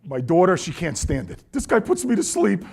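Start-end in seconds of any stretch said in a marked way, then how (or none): 1.5-2.7 higher-pitched whiny complaint; mock complaint. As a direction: imitate a fed-up daughter, pitched up. Quoting daughter's complaint about the CDs.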